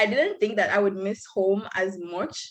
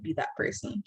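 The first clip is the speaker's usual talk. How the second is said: whispered aside, almost to herself; a hushed, embarrassed whisper; 3 semitones lower, 8 dB quieter than usual. Real, not acted. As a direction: drop to a sheepish whisper. Self-mocking aside.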